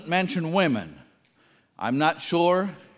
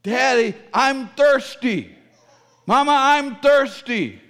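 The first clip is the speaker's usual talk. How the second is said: put-on a child's plea, pitched higher, slow; thirsty child pleading; 5 semitones higher, 4 dB louder, slower than usual. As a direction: plead like a thirsty little kid asking Daddy and Mama. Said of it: "they would say".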